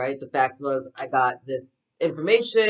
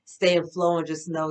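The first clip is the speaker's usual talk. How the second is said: ordinary delivery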